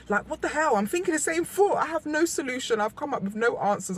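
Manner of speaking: exasperated. Real, not acted. Exasperated at own overthinking.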